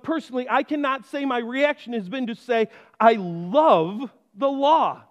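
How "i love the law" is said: very slow, louder "I love the law"; slow emphasis. Acted. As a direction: stretch out "I love the law" with weight. Preacher quoting a confession.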